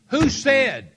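high, loud angry demand; retold anger. Fiancé pressing Jackie for who said it, angry shout. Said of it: "Now, I was mad".

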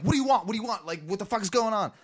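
high, startled rapid-repeat questions; retold panicked demand. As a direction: replay a startled, defensive challenge. Retelling being startled on porch.

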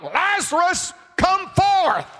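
high, loud shout into the tomb; Jesus shouting at the grave of Lazarus; calling out. Acted. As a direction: call the dead man out. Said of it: "calls out".

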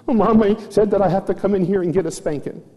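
put-on high, quick tearful little-girl voice; Chloe. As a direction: imitate a crying child tattling on herself. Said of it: "and she's crying".

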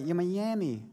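put-on soft, pitiful little toddler plea; imitated toddler crying. Dad imitating baby girl missing her lamb, soft mock crying. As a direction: mimic a small child pleading tearfully, softly. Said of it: "she's crying and she's like".

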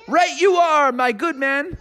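gallant Froggy. Acted. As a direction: agree like a chipper gentleman.

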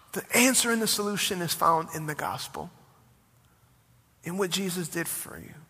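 ordinary delivery

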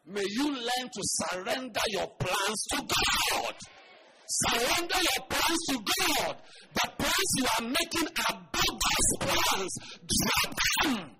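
loud, high preacher's cry; full-force preaching. Real, not acted. Preacher exhorting his congregation, loud and high. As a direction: drive the point home to the crowd.

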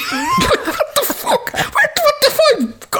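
very high, drawn-out, slow astonished cry; stunned disbelief. Retold or imitated. Uncle hearing the child chant a rebel slogan, slow high astonished disbelief. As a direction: stretch out the shock as if you can't believe your ears. Retelling shock at child's words.

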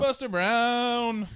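high, slow drawn-out name call; grand entrance announcement. Acted. Announcing the dog in studio.

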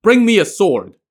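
loud, high commanding call; shouted order. A narrator's reading. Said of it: "He then shouted to the attendants".

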